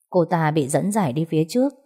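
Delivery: usual speaking voice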